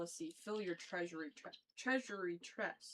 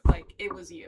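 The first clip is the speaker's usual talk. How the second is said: much louder, punching the blame; loud accusation; 16 dB louder than usual. Retold or imitated. Retelling Tiresias blaming Oedipus, louder. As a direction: throw the blame right back, loudly.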